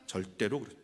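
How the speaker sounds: ordinary talk, nothing marked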